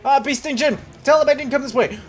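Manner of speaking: high, loud call to a buddy; urgent shout. Pretend. Fleeing player begs Bee Sting Jim, urgent shout. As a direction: shout a hurried plea for cover.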